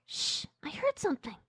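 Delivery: put-on high, hushed girl character; soft, high-pitched warning to a companion; Ella. Ella warns Johnny in the cornfield, high and hushed. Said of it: "Ella's like".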